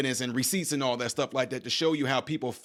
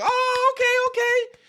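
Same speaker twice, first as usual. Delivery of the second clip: very high-pitched mock approval; high squeaky okay; 3 dB louder, slower than usual. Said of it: "they all was like".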